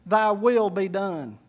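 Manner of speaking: labor through the words as if unable to say them; retold halting, subdued prayer; reluctant prayer. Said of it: "And he couldn't say".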